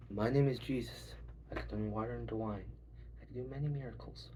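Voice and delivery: soft, low, humble self-introduction; mock Jesus. Jesus introducing himself to the crowd, soft and humble. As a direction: introduce yourself like a shy contestant.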